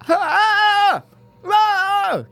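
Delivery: high shrieking scream; freaking out scream. Retold or imitated. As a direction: shriek like a panicked soldier. Imitating David Alan Grier freaking out.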